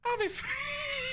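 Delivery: high drawn-out Texan twang; slow high Texan voice; mock Texan; Texan accent. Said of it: "he must be from the States".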